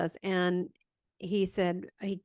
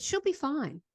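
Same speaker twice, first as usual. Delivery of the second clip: put-on child's voice; 7 semitones higher than usual. Child self answering Jesus.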